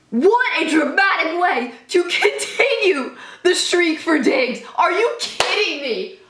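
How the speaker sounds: high, slow, drawn-out amazement; awed disbelief. Real, not acted. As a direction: stretch each word out in awe. Still reeling after the touchdown.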